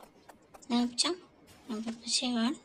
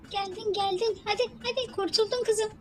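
excited high coaxing; high relieved cheering; 10 semitones higher, 3 dB louder than usual. Real, not acted. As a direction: cheer her through in relief. Relief after jump. (rahatlamış, heyecanlı tezahürat)

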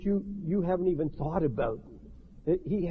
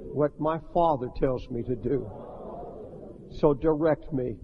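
leading prayer. Shown as a demonstration. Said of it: "pray this with me".

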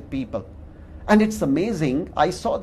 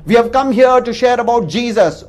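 raised voice toward the gate speaker; calling out; 6 semitones higher, 6 dB louder than usual. Retold or imitated. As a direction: call it out loud so the box hears. They answer the intercom, calling out.

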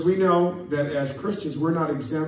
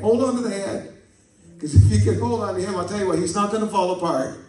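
higher, louder, faster preaching; preaching at full force; 4 semitones higher, 3 dB louder, faster than usual. Real, not acted. Preacher urges the church to hold on, rising and fast. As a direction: drive it home to the congregation.

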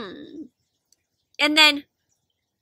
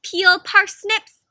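drawn-out bossy mama, slow; slow mom order; 6 semitones higher, 8 dB louder, much slower than usual. Acted. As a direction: spell out the chore slowly. Mama voiced in story.

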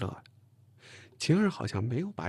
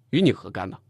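louder, irritated retort; huffy annoyance; 3 semitones lower, 6 dB louder than usual. A narrator's reading. Huffy retort to a colleague who mocked the girl. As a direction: bristle and tell them off. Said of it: "我气哼哼地看着陈静".

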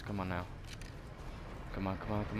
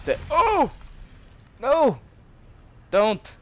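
panicked yelps; 14 semitones higher, 5 dB louder, slower than usual. Real, not acted. About to be killed in game.

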